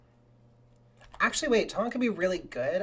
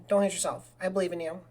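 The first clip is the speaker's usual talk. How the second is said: ordinary talk, nothing marked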